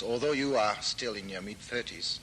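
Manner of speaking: ordinary talk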